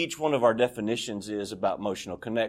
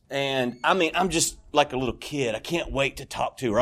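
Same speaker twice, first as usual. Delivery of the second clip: ordinary delivery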